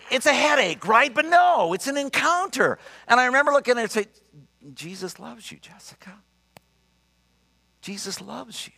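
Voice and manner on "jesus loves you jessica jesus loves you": soft, hushed and tender. Retold or imitated. Pastor gently tells Jessica she is loved, soft and hushed. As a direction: speak it gently to the woman beside you.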